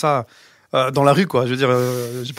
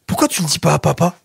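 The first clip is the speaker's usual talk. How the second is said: put-on higher, innocent child's question; lightly acted kid voice, raised pitch; imitated little grandson; 6 semitones higher, 4 dB louder than usual. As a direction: pipe up like a child asking Grandpa. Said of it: "t'as Enzo qui regarde, fait".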